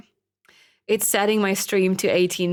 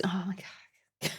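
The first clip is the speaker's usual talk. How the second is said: breathy whispered oh my God; exasperated whisper; 2 semitones lower, 13 dB quieter, slower than usual. Real, not acted. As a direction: whisper it in disbelief. Exasperated reaction to kids.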